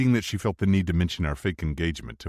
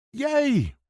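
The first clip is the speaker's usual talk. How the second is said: put-on high, gleeful little girl; child cheering; 4 dB louder than usual. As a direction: squeal it with delight.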